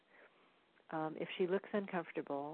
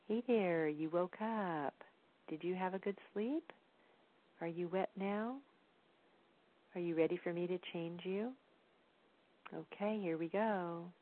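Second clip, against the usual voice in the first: put-on talking to baby. Parent greets baby who just woke up, gentle and unhurried. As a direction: check in with a baby, leaving pauses for answers. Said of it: "things you might want to tell your baby".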